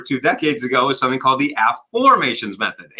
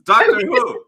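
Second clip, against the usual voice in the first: much higher, thrilled "Doctor Who"; excited fan squeal; 9 semitones higher, 3 dB louder, slower than usual. Retold or imitated. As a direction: squeal it like a delighted nerd. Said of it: "all the nerds are going".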